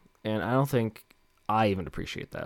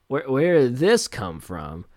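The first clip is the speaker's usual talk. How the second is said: higher, louder startled whoa with a laugh; loud half-laughing disbelief; mock shock; 4 semitones higher, 7 dB louder, slower than usual. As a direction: play the baffled newbie, caught off guard. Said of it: "And then you're like".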